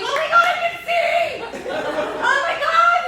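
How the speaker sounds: very high, loud joyful scream; squealing with relief; ecstatic shriek. Retold or imitated. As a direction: burst out in disbelieving joy. Suddenly able to see.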